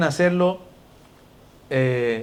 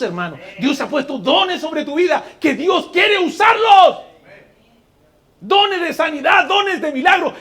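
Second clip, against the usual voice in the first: fiery preaching; 8 semitones higher, 5 dB louder than usual. Acted. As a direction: preach it at full force to the congregation. Preacher builds to full force.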